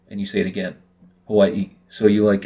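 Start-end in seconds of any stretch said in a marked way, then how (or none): 1.3-1.7 "Hawaii" with a small catch between the two final vowels; both I's pronounced. Shown as a demonstration. Speaker repeating the okina example, "Hawaii" with both I's pronounced. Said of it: "actually pronounce both I's".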